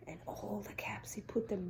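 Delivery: soft hushed whisper. A narrator's reading. Storyteller whispering the last caps. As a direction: breathe it softly.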